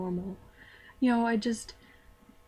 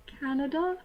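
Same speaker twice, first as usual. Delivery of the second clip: put-on higher, questioning, vaguely British accent; 5 semitones higher than usual. Embarrassed student saying where she's from, in a vaguely British accent. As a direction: answer with a slight British lilt, rising at the end. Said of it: "Still sounding vaguely British".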